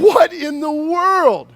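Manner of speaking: high, slow astonished cry; amazed. Pretend. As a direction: gape at the walls of water. Israelite amazed between walls.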